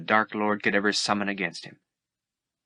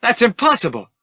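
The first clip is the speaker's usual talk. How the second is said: much higher, louder desperate denial; disbelieving cry; 14 semitones higher, 8 dB louder than usual. Acted. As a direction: refuse to hear it. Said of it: "refusing to believe".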